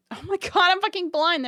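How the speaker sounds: frustrated cry at herself; exasperated. Real, not acted. Can't find something in front.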